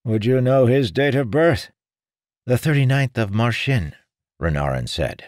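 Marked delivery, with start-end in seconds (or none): 0.0-1.7 put-on higher old man's voice; old seer voice. 2.4-3.9 put-on young man voice. Renarin answers.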